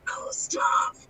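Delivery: loud, disgusting guttural croak; guttural and disgusting character voice. Shown as a demonstration. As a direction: growl it from the throat, Beetlejuice-style. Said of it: "guttural and disgusting".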